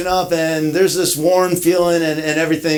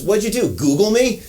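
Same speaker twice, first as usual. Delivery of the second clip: annoyed tone; 5 semitones higher than usual. Retold or imitated. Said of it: "this kind of annoyed tone to his voice".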